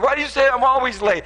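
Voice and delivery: mock-raising his voice at his wife. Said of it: "I'm gonna raise my voice a little bit".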